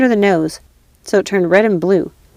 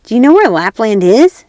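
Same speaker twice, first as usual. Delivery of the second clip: louder, gleeful menace; robber girl; 3 semitones higher, 7 dB louder than usual. Acted. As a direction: relish the knife, cruelly playful.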